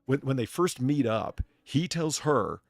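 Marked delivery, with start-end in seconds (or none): none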